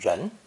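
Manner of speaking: "ren" gliding upward in pitch; second rising tone. Shown as a demonstration. Teacher introducing the character for person, rising "ren". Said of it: "pronounced with a second rising tone".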